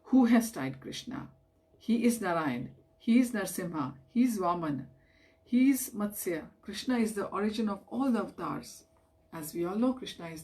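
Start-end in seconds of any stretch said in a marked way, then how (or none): none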